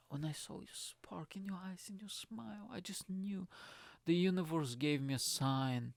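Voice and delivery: hushed, whispery cheesy-movie lover, slowing right down; hammed-up soft swooning with long, tender pauses; mock Hallmark romance. Man going proper Hallmark on her, mock-romantic hush. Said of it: "I was just going proper Hallmark on her".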